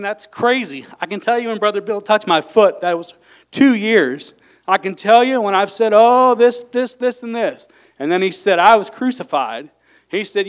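loud, rising fervent preaching. Acted. Pastor testifying to his congregation, full-force preaching. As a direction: preach it with full fire to the church.

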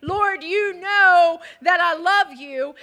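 slow, loud, fervent vow; loud declaration. Acted. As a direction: declare it with full force. Climactic declaration of twelve men.

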